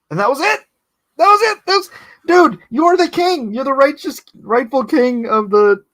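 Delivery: high, loud comic indignation; incredulous outburst. Acted. Viewer protesting the movie's ending to the character, incredulous. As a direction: protest in disbelief, pitch shooting way up. Mock outrage at film's missing payoff.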